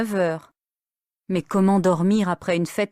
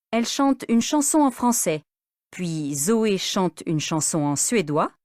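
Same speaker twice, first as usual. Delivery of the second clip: plain reading voice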